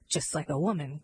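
low grumble under the breath; grumbling. A narrator's reading. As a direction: grumble to yourself, pitch dropped. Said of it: "grumbled one of the men under his breath".

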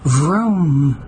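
drawn-out "vroom", pitch swooping up and down; imitated engine vroom. A narrator's reading. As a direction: rev it like an engine catching. Narrator imitates engine starting.

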